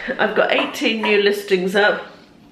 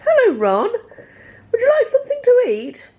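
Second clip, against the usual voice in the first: high cooing voice to a pet; sweet talk the cat, much higher; slower than usual. Real, not acted.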